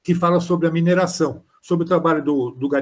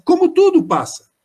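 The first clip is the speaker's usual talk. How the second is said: emphatic; 12 semitones higher, 6 dB louder than usual. Real, not acted. Poignant climax of haiku reading.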